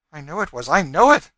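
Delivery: high, agitated insistence rising in force; wild. A narrator's reading. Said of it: "said she wildly".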